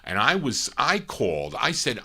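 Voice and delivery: ordinary delivery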